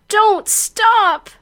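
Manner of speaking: two words run into one high cry. Acted. Heroine urging him on, crying out high and loud. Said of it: "Wonder Woman is crying out".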